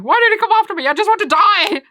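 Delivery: anguished high yell; Nico Robin. Acted. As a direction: cry out loudly in hammed-up despair. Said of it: "And then Nico Robin tells him".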